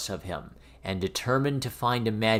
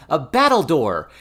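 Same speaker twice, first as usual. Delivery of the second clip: put-on a child's loud eager cry; excited shout of a child; cried out; 4 semitones higher, 8 dB louder than usual. A narrator's reading. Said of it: "The next one cried".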